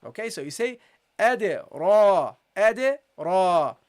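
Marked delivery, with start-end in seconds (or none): 1.2-3.8 ade with bounced d, ra heavy; bounce and heavy ra. Shown as a demonstration. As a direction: ade popped, then a dark heavy ra. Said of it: "You have to pronounce it heavily".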